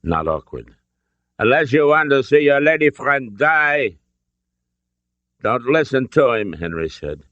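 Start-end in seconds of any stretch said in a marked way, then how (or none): 1.4-4.0 put-on high, menacing German-accented threat; thick German accent. A narrator's reading. Colonel threatens Indy's companion, thick German accent. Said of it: "his thick accent". 5.4-6.5 raised, higher-pitched warning; urgent. A narrator's reading. Father warns his son at gunpoint, urgent.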